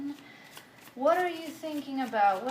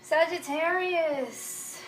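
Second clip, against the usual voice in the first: drawn-out sign announcement; 5 semitones higher, 5 dB louder, much slower than usual. Acted. Opening the tarot reading.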